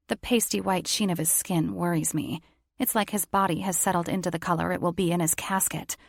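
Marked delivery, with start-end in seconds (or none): none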